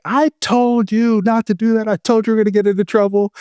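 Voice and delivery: squeaky told-you-so scolding; mimicking the wife. Wife scolds husband during search, in a high mock voice. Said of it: "And she is like".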